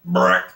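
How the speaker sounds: very low rumbling fart voice; fart-like word. Retold or imitated. Man answers from his ass, deep fart sound. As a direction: drop way down and blurt it like gas. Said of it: "that's the sound that it came out when it came out of his ass".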